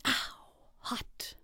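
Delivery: hushed pained gasp; whispered ow. Acted. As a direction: yelp under the breath. Burning her hand on the oven.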